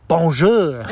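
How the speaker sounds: a hammed-up French bonjour; mocking the fairy language as French, in a put-on accent. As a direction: say it like a snooty Frenchman.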